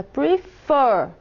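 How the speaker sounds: "Prefer" with a strong second syllable; stress on last syllable. Shown as a demonstration. Teacher illustrating the accented syllable, "Prefer" stressed at the end. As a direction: punch the final syllable of "Prefer". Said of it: "é a sílaba mais acentuada".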